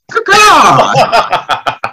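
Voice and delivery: high cawing bird call; silly bird cry. Acted. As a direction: caw like a drunk bird. Drunk governor acts as bird.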